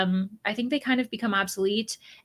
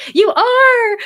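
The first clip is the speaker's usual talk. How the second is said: much higher, much louder cry of delight; excited shout; 14 semitones higher, 12 dB louder than usual. Real, not acted. Spotting a viewer she knows in chat, delighted high shout. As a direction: burst out as you spot a friend.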